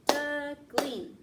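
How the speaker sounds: Duckling split into two loud beats, duck and ling; clapped in beats. Shown as a demonstration. Teacher clapping syllables for kids, loud and clear. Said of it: "How many times did we clap".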